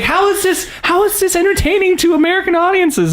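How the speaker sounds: high, baffled mock disbelief; incredulous. Asking friends who love Downton Abbey, incredulous and high. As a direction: scoff at it in disbelief. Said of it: "I'm like".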